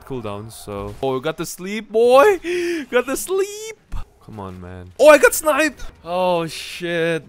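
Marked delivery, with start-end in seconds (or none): 1.0-3.8 high, loud triumphant yelling; excited yell. Real, not acted. Landing the sleep ability. 5.0-7.3 high, loud shocked shout; shocked yell. Real, not acted. Gets sniped.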